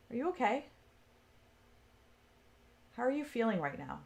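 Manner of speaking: soft, tender questions; tender concern. Retold or imitated. Speaking softly to her own inner voice, tender and caring. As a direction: ask gently, like checking on a friend.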